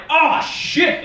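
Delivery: loud, high startled curse; mock panic; caught culprit. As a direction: blurt it as you bolt. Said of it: "The devil's like".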